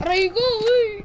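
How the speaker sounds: sharp gamer ouch; pained yelp. Real, not acted. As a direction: yelp as you get hit. Hit in game.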